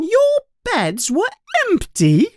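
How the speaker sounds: very high, sharp mother's scold; angry scolding. Acted. Mother confronting her sons at dawn, angry and high. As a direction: fling the accusation at them. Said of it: "she continues".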